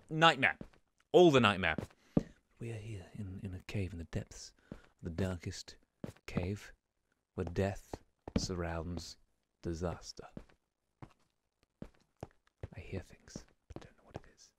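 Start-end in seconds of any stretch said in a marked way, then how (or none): 2.6-10.2 hushed, low nature-documentary narrator; very soft, grave narration with dramatic pauses; David Attenborough. Acted. Narrator describing the deadly cave, in an Attenborough impression. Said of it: "I can't do a David Attenborough accent". 12.7-14.5 barely audible hushed whisper; wary whisper. Real, not acted. Player hearing things in the cave, in a wary whisper. Listening nervously for mobs.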